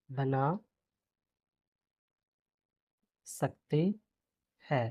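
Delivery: soft, word by word dictation; quiet slow dictation. Shown as a demonstration. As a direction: murmur each word as you write it. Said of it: "teacher dictating while writing".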